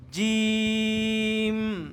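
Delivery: জিম drawn out very slowly for repeating; letter name, slow. Shown as a demonstration. Teacher says the letter name for students to repeat, slow. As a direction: say জিম slowly so they echo. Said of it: "এটার নাম আমার সাথে সাথে বলা".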